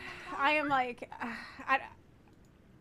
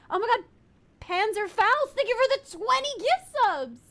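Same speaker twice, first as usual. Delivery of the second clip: excited; 6 semitones higher, 4 dB louder than usual. Real, not acted. A big gift arrives.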